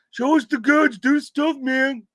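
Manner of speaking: high, loud mock demand; impatient viewer mimicry. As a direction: pester in a high put-on voice.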